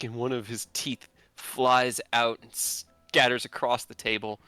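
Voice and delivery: usual speaking voice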